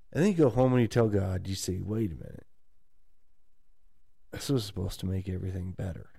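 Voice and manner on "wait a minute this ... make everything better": soft, deflated prayer to God; quiet disappointment. Retold or imitated. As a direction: murmur your letdown to God.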